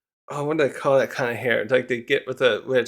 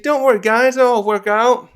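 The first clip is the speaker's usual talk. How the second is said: higher, louder perky voice; perky reassurance; 8 semitones higher, 5 dB louder than usual. Acted. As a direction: chirp it like the perky little one. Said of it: "still kinda perky".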